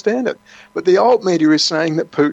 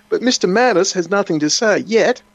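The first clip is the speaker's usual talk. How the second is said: usual speaking voice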